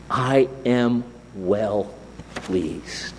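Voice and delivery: slow, low and hushed divine assurance; slow fatherly tenderness, dropping to near silence; tender blessing. Acted. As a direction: let each word sink in, softening to the end. Voicing God's love to listeners.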